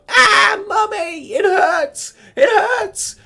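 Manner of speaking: very high, loud shrieking sob for mummy; crybaby wail. Retold or imitated. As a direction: howl like a hurt toddler calling mummy. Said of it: "gagging like a little baby".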